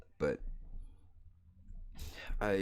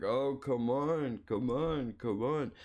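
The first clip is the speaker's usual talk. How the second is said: stretched-out, higher coaxing; drawn-out mock begging; mock pleading; 5 semitones higher, slower than usual. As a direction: imitate her egging you on. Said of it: "and she's like".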